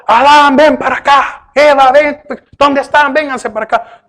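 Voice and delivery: an angry God hollering for Adam and Eve; yelling call. Retold or imitated. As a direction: bark the names like an angry parent. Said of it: "No dice que le gritó".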